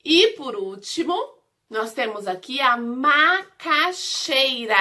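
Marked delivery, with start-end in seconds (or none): 2.8-4.8 "macaxeira" slowly, in separated chunks; syllable by syllable. Shown as a demonstration. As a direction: break "macaxeira" up for young learners. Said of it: "Nós vimos as sílabas".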